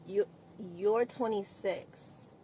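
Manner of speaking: ordinary talk, nothing marked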